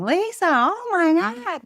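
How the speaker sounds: high, loud mock scream; hammed-up, high-pitched yell; imitated screaming. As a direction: imitate an overwrought movie character screaming. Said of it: "Wyatt just kept screaming".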